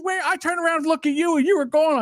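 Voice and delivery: high-pitched agitated quote of a companion; agitated retelling. Retold or imitated. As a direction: quote him worked up, pitch way up. Retelling a companion's startled words.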